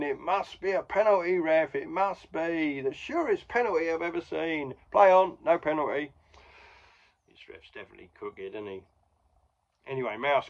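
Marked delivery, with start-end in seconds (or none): 0.0-4.7 high, loud appeal to the ref; pleading appeal. Retold or imitated. 4.9-6.1 loud, high curt call; ref overruling. Retold or imitated. Ref refusing penalty.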